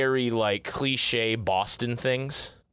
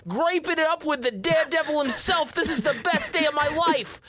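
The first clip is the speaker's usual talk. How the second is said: much higher hammed-up Boston accent, slowing down; Boston brother. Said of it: "And the exchange is".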